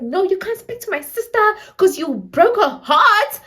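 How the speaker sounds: high, loud, hammed-up scolding; mock angry sister. Sister refusing to let him speak to her, mock angry scolding. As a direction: tell him off in exaggerated outrage. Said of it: "she was also just mad".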